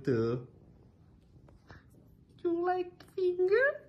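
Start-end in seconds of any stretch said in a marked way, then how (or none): 2.4-3.8 very high, soft cooing question. Real, not acted. Owner cooing at her dog in a high voice.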